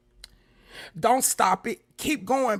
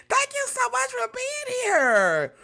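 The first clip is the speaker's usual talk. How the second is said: high gushing thanks; 14 semitones higher, slower than usual. Real, not acted. Thanking a viewer with emotion.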